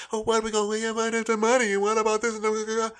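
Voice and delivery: rapid, squeaky mock worry; high-pitched fretting. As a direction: rattle off worries in a high put-on voice. Frets in put-on high voice.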